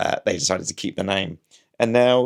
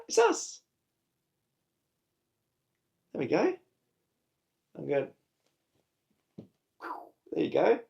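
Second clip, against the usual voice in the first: higher, soft pet voice; coaxing the cat; 7 semitones higher, 4 dB quieter than usual. Acted. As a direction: coo softly at the cat.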